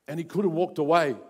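usual speaking voice

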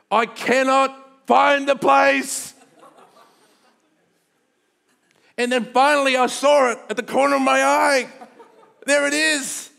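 loud frantic climax with a laugh. Retold or imitated. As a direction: shout it out as the panic peaks. Climax of panicked search.

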